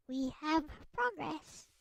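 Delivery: ordinary delivery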